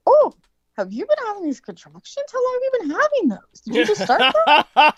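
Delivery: high-pitched, incredulous questioning; surprised nurse. Retold or imitated. Nurse finding her patient in contractions, high surprised questioning. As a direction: mimic a nurse caught off guard, pitch leaping up. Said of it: "she's like".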